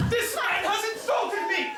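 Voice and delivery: high, slow, hammed-up cry of insult; mock outrage. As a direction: feign offence loudly to pick the quarrel. Staged quarrel for a duel.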